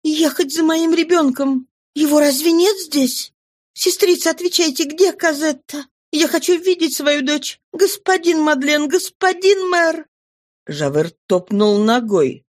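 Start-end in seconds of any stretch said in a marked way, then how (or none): 0.0-10.1 high, desperate calls for help; frantic pleading. A narrator's reading. Dying Fantine pleading for her daughter, high and frantic. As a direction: beg the nun and the mayor in panic.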